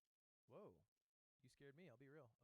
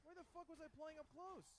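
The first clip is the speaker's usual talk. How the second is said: frustrated; 11 semitones higher, 6 dB louder than usual. Real, not acted. Frustrated mid-fight.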